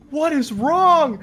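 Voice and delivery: high, drawn-out mock alarm; exaggerated high-pitched dismay; alarmed parent. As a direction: cry it out like a baffled parent. Parents woken by the commotion.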